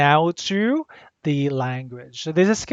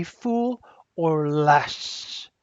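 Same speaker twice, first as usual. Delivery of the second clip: drawn-out reminder; 3 dB quieter, much slower than usual. Shown as a demonstration. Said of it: "remember, ha?".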